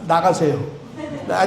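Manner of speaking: ordinary talk, nothing marked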